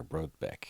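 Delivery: Brobeck said softly with a T before the b; T pronounced. Shown as a demonstration. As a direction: say Brobeck as "brote-beck". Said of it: "It's technically".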